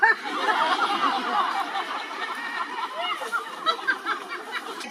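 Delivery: long, high cackling laugh at her own joke; big laugh. Real, not acted. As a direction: crack up at the punchline.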